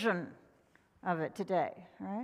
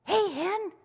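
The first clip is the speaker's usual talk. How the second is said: higher casual squirrel; 8 semitones higher, 3 dB louder than usual. Acted. Squirrel answering the hen, higher voice. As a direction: toss off a lazy hello. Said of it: "Squirrel looked up".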